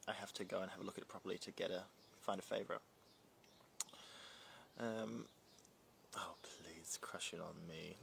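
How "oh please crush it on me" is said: whispered, bashful; coy whisper. Pretend. Whispering bashfully back to a flattering viewer.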